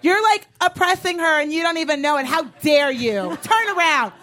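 high-pitched telling-off into repeated commands; acted indignant scolding. Girlfriends send the drunk man back to his table, high mock outrage. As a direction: order him away in hammed-up outrage.

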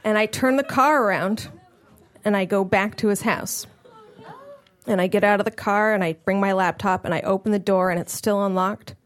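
usual speaking voice

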